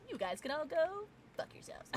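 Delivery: unvoiced playful whisper; a cheeky whispered curse to chat. Real, not acted. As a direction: breathe the insult as a joke. Whispered mock insult.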